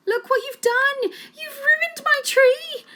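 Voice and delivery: high, loud, slow scream. A narrator's reading. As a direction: scream at the ruined tree. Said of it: "A J's mum screamed".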